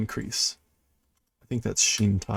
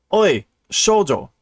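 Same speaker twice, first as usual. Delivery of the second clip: gruff call; 8 semitones higher, 6 dB louder than usual. Retold or imitated. Reading gruff character line.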